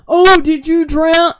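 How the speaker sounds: high, loud, slow mock worried grandma. Mocking grandma asking after her bath if she drowned, high and loud. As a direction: stretch it out like a fussing grandma. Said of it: "it's like".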